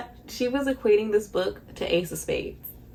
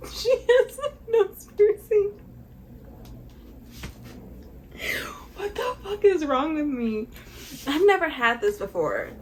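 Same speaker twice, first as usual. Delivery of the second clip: crying; 9 semitones higher than usual. Real, not acted. Reader crying over a character like her, high and tearful. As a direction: sob it out up high. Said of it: "Something's in my eyes".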